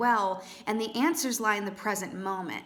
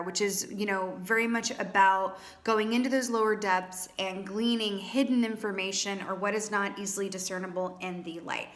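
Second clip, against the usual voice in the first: ordinary talk, nothing marked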